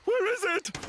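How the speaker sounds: panicked cry. Acted. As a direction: call it out in rising panic. Searching for the lost signal.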